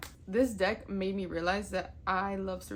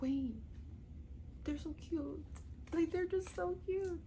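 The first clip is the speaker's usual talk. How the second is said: higher, soft cooing over the cute cards; gushing over cuteness; 8 semitones higher, 7 dB quieter than usual. Retold or imitated. Gushing to viewers over tiny creature cards, cooing. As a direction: coo like seeing a kitten. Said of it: "But then I was like".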